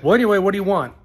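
mock rude brush-off. Owner snapping at a customer on the phone, impatient. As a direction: bark it like you're annoyed.

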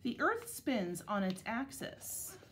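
measured picture-book reading with clear pauses; unhurried, carefully articulated storybook narration; read-aloud to children. Acted. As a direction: read it to second graders, phrase by phrase. Reads picture book to second graders.

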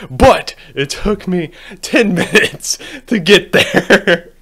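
laughing through the words. Real, not acted. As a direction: chuckle while saying it. Laughs after finishing.

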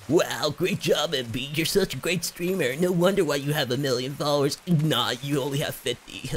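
mock himself like a snide viewer; mock praise. Streamer mocking himself as a viewer, sarcastic. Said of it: "You suck".

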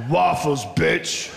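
low, lazy hungover grunt; deep hungover grunt; grunted two-word sentence. Retold or imitated. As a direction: mumble it like you're too wrecked for full sentences. Hungover friends grunting at breakfast.

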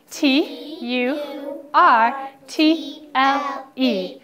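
letters drawn out one by one, loud; spelled slowly. Shown as a demonstration. Teacher modelling the spelling for students, slow and loud. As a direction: spell each letter slowly for the class to check. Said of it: "Polka dot check starting T".

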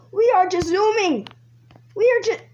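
high, loud and thrilled; excited shout. Real, not acted. Player zooming past cops, high excited shouting. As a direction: call it out, carried away by speed.

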